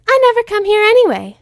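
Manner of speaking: high, loud and bouncy; bubbly Pinky voice. Acted. Pinky darting off toward Sea Swirl, high and giddy. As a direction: squeal it out like an excited pony.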